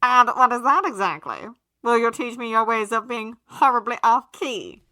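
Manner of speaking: loud, pompous, theatrical aristocrat; haughty gentleman voice. A narrator's reading. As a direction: sneer it grandly like a snob.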